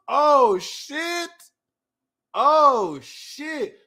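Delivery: high startled oh shit, trailing off; shocked exclamation. Real, not acted. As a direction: gasp it out in surprise. Shocked at album news.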